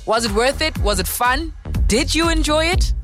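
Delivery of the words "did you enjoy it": normal talking voice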